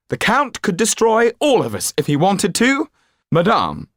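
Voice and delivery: high, loud, rigid warning; stiff Marquis. Acted. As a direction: speak stiffly and tensely. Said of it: "said the Marquis stiffly".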